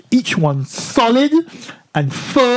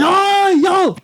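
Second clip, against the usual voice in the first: put-on high-pitched Jamaican accent, calling out; mock-thick Jamaican call to someone far off; Jamaican shout; 12 semitones higher, slower than usual. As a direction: holler across in an imitated Jamaican accent. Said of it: "he shouts at me".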